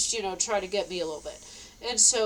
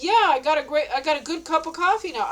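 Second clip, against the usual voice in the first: happy boast; 5 semitones higher, 5 dB louder, faster than usual. Retold or imitated. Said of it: "I'm like".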